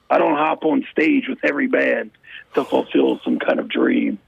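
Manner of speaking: low wrestling promo growl. Acted. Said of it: "I love the wrestling voice".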